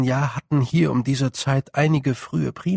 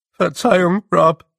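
high, loud and flustered; squeaky sheepish apology; 5 semitones higher, 8 dB louder than usual. Acted. Dover apologises to Rob for saying the word again, squeaky and sheepish. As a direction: blurt the excuse, embarrassed.

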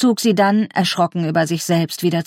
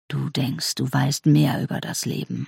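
quiet menace; 5 semitones lower, 6 dB quieter than usual. Acted. Father's quiet menacing speech.

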